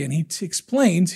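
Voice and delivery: normal talking voice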